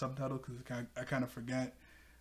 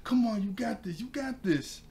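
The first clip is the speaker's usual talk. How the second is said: cheering mechanic; 8 semitones higher than usual. Retold or imitated. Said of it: "He goes".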